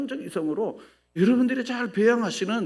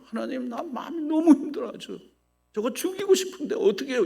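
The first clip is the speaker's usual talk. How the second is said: crying out to God; 8 semitones higher than usual. Acted. Acted anguished prayer.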